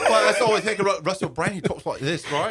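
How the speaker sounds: hammed-up high British Russell Brand; British accent. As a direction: do a high-pitched, silly British impression. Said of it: "That's a pretty good British accent".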